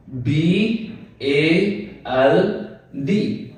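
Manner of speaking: each letter named slowly with long gaps; spelled out slowly. Shown as a demonstration. Teacher spelling the word slowly for students. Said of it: "ये हमारे पास word है".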